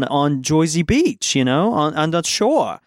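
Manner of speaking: slow, hammed-up New Jersey accent; a playful jab at New Jersey speech, drawn out; mock New Jersey accent. Telling cohost about Jersey shore vacation, mock New Jersey accent. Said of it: "That was the worst accent I've ever heard".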